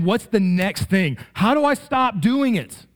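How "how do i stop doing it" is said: put-on panicked child.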